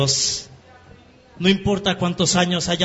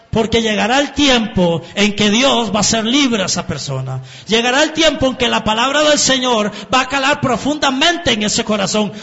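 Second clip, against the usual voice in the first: higher and louder, pressing preacher's build; fervent preaching; 7 semitones higher, 4 dB louder than usual. Acted. Preacher urging the congregation not to give up, fervent preaching. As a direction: preach it, climbing toward the climax. Preaching, rising to full force.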